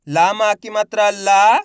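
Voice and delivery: ला stretched long, slow and loud; drawn-out syllable reading. Shown as a demonstration. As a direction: read ला drawing the vowel out. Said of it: "तो खींच के पढ़ेंगे". (ला को खींच के पढ़ो)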